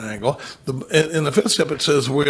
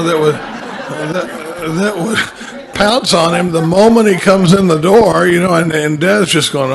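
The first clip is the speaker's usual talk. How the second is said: laughing through words; 4 semitones higher, 7 dB louder, slower than usual. Real, not acted. Laughing while describing needy kid.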